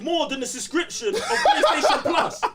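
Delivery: high, loud words broken by laughter; laughing outrage. Real, not acted. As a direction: laugh it out in disbelief. Laughing outrage over price.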